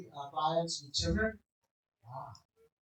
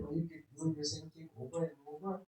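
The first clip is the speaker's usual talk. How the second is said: ordinary talk, nothing marked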